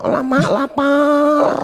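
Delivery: very high, drawn-out hungry wail; high-pitched slow imitation of someone yelling; mock yelling. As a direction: stretch it out like a mock cry for food. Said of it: "Lagi teriak".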